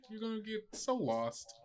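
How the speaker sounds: ordinary delivery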